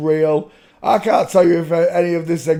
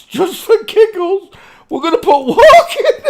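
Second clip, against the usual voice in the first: much higher, louder, giggles bursting through; breaking into laughter; 7 dB louder than usual. Real, not acted. Host searching just for giggles, voice cracking into laughter. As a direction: let laughter take over the words.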